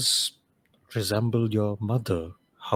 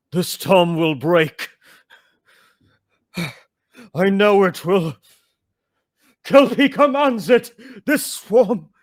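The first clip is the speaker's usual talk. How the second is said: father yelling; 9 semitones higher, 8 dB louder than usual. Acted. Chief yelling his faith that the storm will break, loud defiant cry. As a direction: roar a vow into the storm. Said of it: "yelling out".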